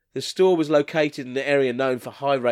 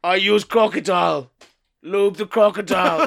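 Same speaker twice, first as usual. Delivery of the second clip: loud, higher caveman voice; 4 semitones higher, 5 dB louder than usual. Acted. Caveman answering about condoms, caveman voice. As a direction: blurt it out like a caveman.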